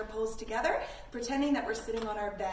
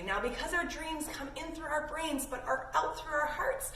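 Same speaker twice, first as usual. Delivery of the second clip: ordinary delivery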